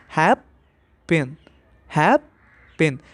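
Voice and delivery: "हैप पिन" split in two, stress on "हैप"; stress on first syllable. Shown as a demonstration. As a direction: lean on "हैप", pause, then let "पिन" go lighter. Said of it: "उसमें ज़्यादा stress है".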